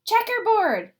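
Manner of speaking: high loud shout; kid shouting. Acted. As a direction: yell it across the classroom. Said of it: "Someone else shouted".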